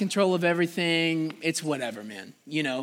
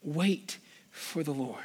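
low, soft voiced reading leaning on "Wait"; soft spoken scripture; 4 semitones lower, 8 dB quieter than usual. Real, not acted. Preacher repeating the psalm's verse to church, soft and voiced. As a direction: settle it gently.

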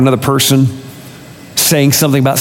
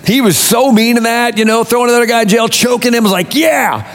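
high, driving sermon delivery; indignant preaching; 8 semitones higher than usual. Real, not acted. Preacher confronting the hypocritical servant, indignant preaching. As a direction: hammer the accusation with high pitch.

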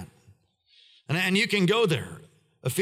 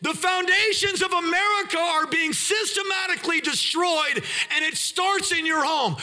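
shouted preaching; 14 semitones higher than usual. Real, not acted. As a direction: preach it at full force. Preacher shouting at full force.

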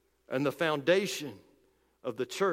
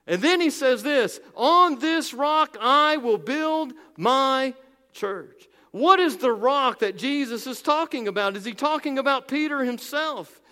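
raised, driving sermon voice; fervent preaching; 6 semitones higher, 4 dB louder than usual. Acted. Preacher rousing his congregation, fervent preaching. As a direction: push the point home like a pulpit preacher.

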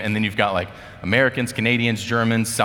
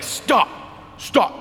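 high, sharp imagined order; mock scolding call; 11 semitones higher than usual. Teammates halting a bad pass, mock high call. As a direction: call a halt, pitched well up.

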